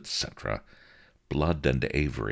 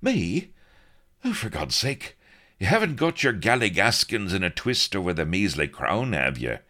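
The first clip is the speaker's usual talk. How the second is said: higher, louder exasperated voice; exasperated Blood; 7 semitones higher, 4 dB louder than usual. A narrator's reading. Blood scoffs at Avery, higher and exasperated. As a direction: scoff in a raised pitch.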